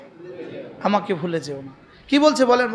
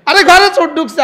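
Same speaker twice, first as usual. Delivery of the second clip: alarmed cry; 6 semitones higher, 6 dB louder than usual. Retold or imitated. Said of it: "wife shouting thief alarm".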